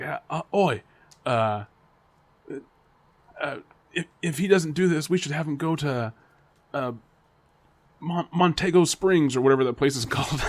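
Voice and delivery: put-on halting go at an Aussie accent; attempted Australian accent. Teachers planning where to send him, shaky attempted Australian accent. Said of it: "It sounds very Spanish, not, not Australian".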